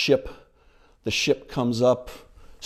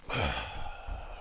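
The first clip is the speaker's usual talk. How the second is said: low heavy sigh; 13 semitones lower, 11 dB quieter than usual. Retold or imitated. Luke giving up, sighing.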